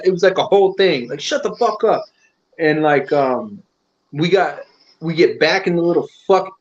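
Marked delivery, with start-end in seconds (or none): 1.2-2.0 mock angry outburst. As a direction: imitate someone silencing a complainer. Repeats the retold silencing.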